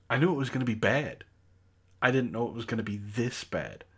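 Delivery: ordinary talk, nothing marked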